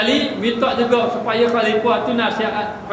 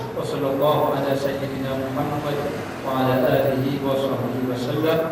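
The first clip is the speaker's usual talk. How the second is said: bacaan doa yang rendah dan lembut; doa penutup; 6 semitones lower, 4 dB quieter than usual. Acted. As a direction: tunduk berdoa dengan tenang. (penceramah menutup majlis dengan doa, suara rendah dan lembut)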